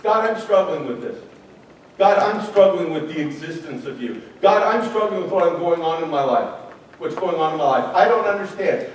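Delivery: soft sincere prayer; quiet honest prayer. Retold or imitated. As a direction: pray it humbly. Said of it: "Well, who's God then".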